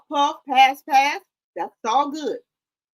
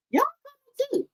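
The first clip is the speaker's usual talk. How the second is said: playful falsetto; 13 semitones higher, slower than usual. Pretend. Teasing viewers about the party.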